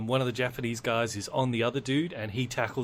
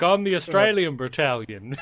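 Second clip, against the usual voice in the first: mock Australian accent; 4 semitones higher, 6 dB louder than usual. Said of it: "he can't do an American accent".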